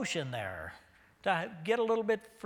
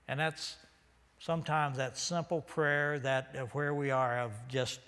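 ordinary talk, nothing marked

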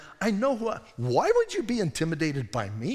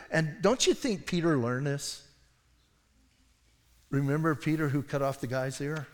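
ordinary talk, nothing marked

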